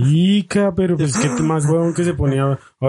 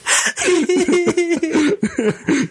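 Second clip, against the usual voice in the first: shrieking high burst of laughter. Real, not acted. Friend cracking up at the punchline, very high laugh. End of funny story.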